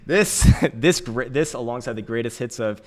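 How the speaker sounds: ordinary talk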